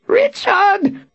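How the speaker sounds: high, squeaked cry; squeaking. A narrator's reading. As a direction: squeak out the name. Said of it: "he squeaked".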